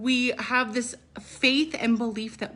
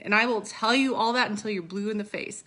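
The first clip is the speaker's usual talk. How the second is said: ordinary talk, nothing marked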